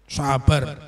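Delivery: usual speaking voice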